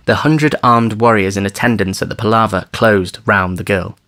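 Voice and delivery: ordinary delivery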